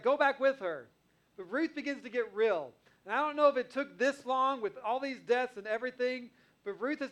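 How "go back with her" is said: louder, higher urgent plea to go back; imitating Naomi. Naomi urging Ruth to leave, raised voice. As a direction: push her away with a raised voice. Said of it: "She's like".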